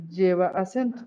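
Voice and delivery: usual speaking voice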